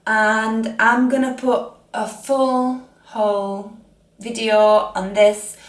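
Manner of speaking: usual speaking voice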